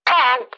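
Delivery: loud forceful high honk; swan honk. Shown as a demonstration. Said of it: "put some force behind it".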